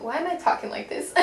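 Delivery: ordinary talk, nothing marked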